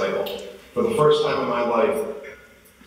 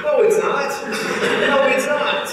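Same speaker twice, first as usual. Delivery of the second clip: very high, loud mock protest; emphatic contradiction; 5 dB louder than usual. Husband disputes wife's downhill claim, in high, loud mock protest. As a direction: squeal the denial for comic effect.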